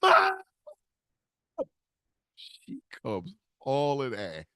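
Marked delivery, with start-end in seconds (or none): 0.0-0.3 much higher, loud call across the room; scream. Retold or imitated. Son screaming for his mother across the casino. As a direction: scream for mom over the crowd. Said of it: "I scream".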